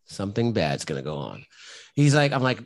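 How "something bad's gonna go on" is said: low, hushed retold inner panic; mock dread. Firefighter recalling his fear when called up. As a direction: mutter it like something bad is coming. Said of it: "And I'm, I'm sitting there going".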